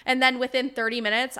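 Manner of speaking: usual speaking voice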